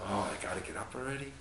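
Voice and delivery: low, soft, fast sleepy grumble; acted groggy complaint, very quiet; waking-up grumble. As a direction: mumble it like someone just woken. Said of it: "the person wakes up and says".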